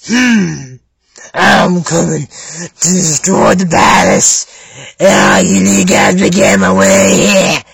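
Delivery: very low, slow brute voice; big dude voice. Acted. Big dude threatening to destroy the palace, deep slow voice. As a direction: drag it out like a big lumbering dude. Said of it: "this big dude who talks like this".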